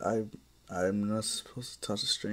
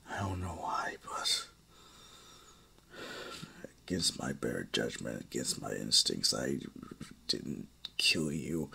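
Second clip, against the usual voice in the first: hushed confession; 7 semitones lower, 5 dB quieter than usual. Acted. Maul admits he spared Izuku.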